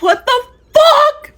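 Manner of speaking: high, slow, laughing disbelief; shocked disbelief. Retold or imitated. Narrator recalling the trunk surprise, high laughing disbelief. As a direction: stretch it out, high and incredulous. Date's friend crawls out trunk.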